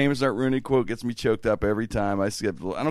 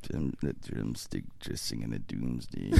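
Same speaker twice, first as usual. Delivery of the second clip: much lower, very soft, slow; sex voice; 16 dB quieter, slower than usual. Pretend. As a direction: croon it low and seductive. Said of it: "that's gonna be the sex voice now".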